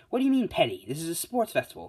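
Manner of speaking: indignant protest. Acted. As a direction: burst out in mock disbelief. Mike protests in acted argument.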